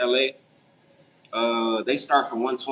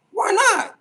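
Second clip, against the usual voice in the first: squeaky surprised; 4 dB louder than usual. Real, not acted. Reacts to chat question.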